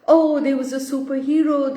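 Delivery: plain reading voice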